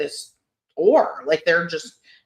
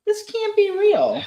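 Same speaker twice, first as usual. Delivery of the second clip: much higher, slower, exaggerated disbelief; high silly voice; 14 semitones higher, slower than usual. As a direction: squeak it out in a put-on high voice. Repeats line in silly voice.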